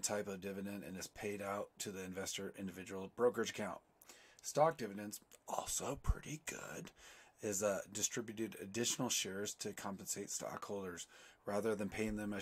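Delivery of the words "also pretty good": slow whispered side note; whispered aside. Real, not acted. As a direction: breathe it out as a private opinion. Whispered aside about stock dividends.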